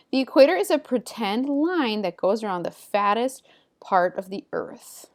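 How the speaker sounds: lively, emphatic textbook reading; animated classroom read-aloud with lifted key words; reading aloud to pupils. Acted. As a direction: read the facts out clearly to a class. Reads textbook passage aloud to pupils.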